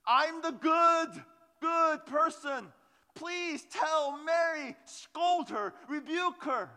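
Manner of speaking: high, loud, slow whiny complaint; tattletale Martha. Retold or imitated. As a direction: whine like a sibling telling on her sister. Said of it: "She begins to tattletale on Mary".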